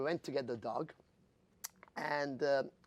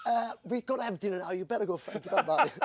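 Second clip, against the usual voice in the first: laughing; 9 semitones higher, 6 dB louder, faster than usual. Retold or imitated. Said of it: "I got escorted out, said".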